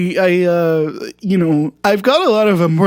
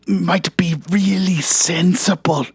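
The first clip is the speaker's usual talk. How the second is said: normal talking voice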